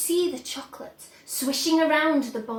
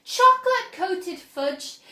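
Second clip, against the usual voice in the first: Wonka explaining; 6 semitones higher, 7 dB louder than usual. A narrator's reading.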